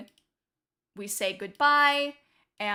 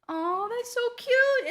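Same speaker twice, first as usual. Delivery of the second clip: cooing; 11 semitones higher than usual. Real, not acted. Cooing over nickname.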